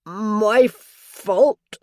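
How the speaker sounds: loud, anguished confession; distressed. A narrator's reading. Dying father to his daughter, distressed and loud. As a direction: blurt out the guilt in distress.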